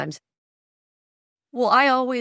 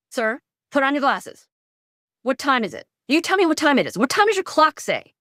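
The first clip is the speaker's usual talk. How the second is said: mock bark; 6 semitones higher than usual. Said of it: "bark at him".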